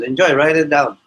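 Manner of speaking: put-on a sly "Enjoy" in an Indian accent; Indian professor voice. Professor handing out hard problems, imitated Indian accent. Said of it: "He goes".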